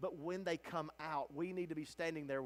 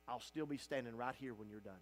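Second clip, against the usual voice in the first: tender vow; 7 semitones lower, 7 dB quieter than usual. Acted. What they wanted to hear.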